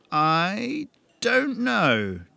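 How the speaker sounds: very slow, high, teasing; playful drawn-out reply; grinning shrug. Acted. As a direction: stretch it out with a grin. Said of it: "Jason grinned".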